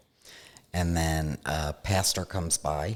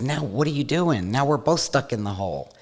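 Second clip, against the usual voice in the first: higher, louder cry of disbelief; alarmed shout; 8 semitones higher, 7 dB louder than usual. Retold or imitated. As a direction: yell it up at the one who just jumped in. Man in hole shouts at rescuer.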